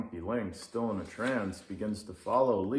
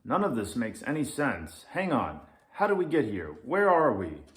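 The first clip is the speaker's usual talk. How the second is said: louder, higher rising panic; getting more and more rattled; alarmed lynx; 5 semitones higher, 6 dB louder than usual. Acted. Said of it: "says the Grumpy Lynx".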